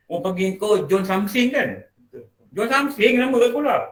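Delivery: high, mocking tease; teasing old schoolmate. Teasing the new boss about his old nickname, mocking.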